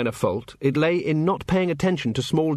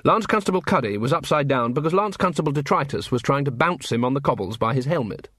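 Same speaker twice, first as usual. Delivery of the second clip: ordinary delivery, nothing marked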